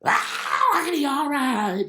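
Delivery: mock church shout of approval; churchgoer hollering. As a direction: holler it like an excited congregation member. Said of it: "I don't do a lot of hollering".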